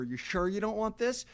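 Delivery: high, wheedling sales pitch, rising; mock pushy upsell. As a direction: needle the buyer like a pushy checkout page. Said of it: "they ask you over and over".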